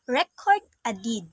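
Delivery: ordinary delivery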